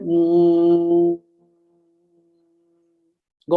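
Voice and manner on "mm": a long even hum, lips closed; humming demo. Shown as a demonstration. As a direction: hum mm as long as you can. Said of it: "Say that".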